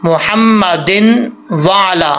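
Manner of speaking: slow मोहम्मदिन वाला, plain n split from वाला; demonstrated mistake. Shown as a demonstration. Teacher repeating what people get wrong, slow unmerged मोहम्मदिन वाला. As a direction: stretch "din", then start वाला separately. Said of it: "कैसे?".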